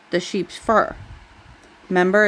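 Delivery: plain reading voice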